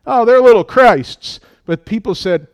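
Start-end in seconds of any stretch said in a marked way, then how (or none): 0.0-1.4 sneering mock voice, higher and louder. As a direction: jeer like a scoffer. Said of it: "That was a mockery".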